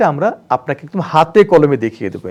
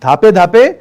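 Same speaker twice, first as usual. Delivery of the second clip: ধাপে ধাপে punched louder with a rise; stress on ধাপে; 6 dB louder, slower than usual. Shown as a demonstration. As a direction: hit ধাপে ধাপে firmly. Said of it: "চাপে নয়".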